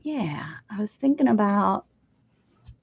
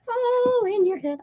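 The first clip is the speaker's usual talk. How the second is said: spooky high voice; 13 semitones higher than usual. Pretend. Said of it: "This is all in your head".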